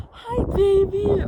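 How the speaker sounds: high, sweet pet voice, rising on hi. Real, not acted. Greeting her cat mid-story, high cooing baby talk.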